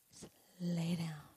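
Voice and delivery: slow soft whisper; whispered soothing. Retold or imitated. God's soothing words.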